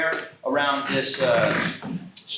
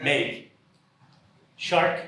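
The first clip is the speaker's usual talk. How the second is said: one word per speaker, halting; halting word-by-word delivery; one word at a time; much slower than usual. Pretend. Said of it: "They can only speak one word at a time".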